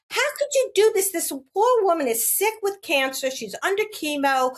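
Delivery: high-pitched scolding, easing slightly as it goes; retold indignation. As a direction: reenact confronting someone who took advantage. Retold confrontation with buying agent.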